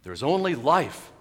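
loud, slow and lifted; happy proclamation. Acted. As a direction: declare it with happy certainty. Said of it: "he says happily".

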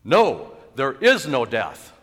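loud, slow, emphatic outburst; forceful shouted contradiction; yelling out. Acted. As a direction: break in with a yelled denial. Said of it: "yells out".

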